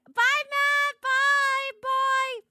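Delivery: high, loud squealed goodbye; excited squeal. Real, not acted. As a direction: squeal it out, overexcited.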